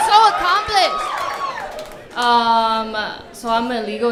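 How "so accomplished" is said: very high, slow, drawn-out sarcastic gush; mock pride. Comic mocking her own plug, high sarcastic gush. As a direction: stretch it out in a mock-proud squeal.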